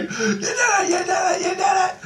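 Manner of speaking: very high squealed celebration; excited cheering. Retold or imitated. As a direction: imitate someone cheering a victory in a squeal. Voices Jesus cheering the victory.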